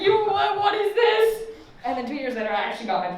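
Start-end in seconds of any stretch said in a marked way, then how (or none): 0.0-1.4 high, squeaky disbelief; incredulous mom, high and loud; mom shocked. Retold or imitated. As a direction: play the mom in shock, pitched way up. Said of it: "She was like".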